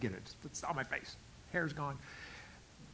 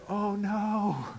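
mock dismay; 4 semitones higher than usual. Mock horror at own face.

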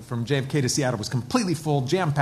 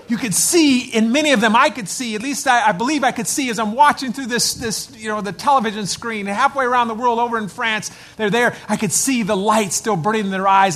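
preaching at full force; 6 semitones higher, 6 dB louder than usual. Real, not acted. Pastor proclaiming the veterans' readiness to the church, fervent. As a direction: build the fire, proclaim it.

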